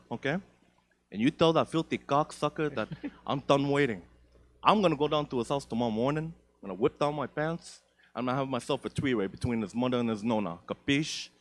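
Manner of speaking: put-on a light Italian-American mobster accent. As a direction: lightly play the tough Italian mobster threatening. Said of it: "I responded with an Italian-American accent".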